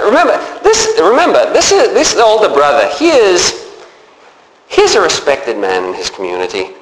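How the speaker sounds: ordinary talk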